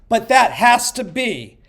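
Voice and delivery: ordinary talk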